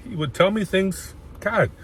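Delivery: high, cracking with real emotion; choked up, fighting back tears; real tears rising mid-story; voice breaking. Real, not acted. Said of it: "I'm gonna cry again".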